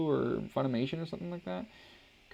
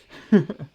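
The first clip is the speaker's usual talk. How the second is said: loud laugh; 4 semitones higher, 12 dB louder than usual. Real, not acted. Laughs at own story.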